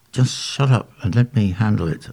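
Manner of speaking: usual speaking voice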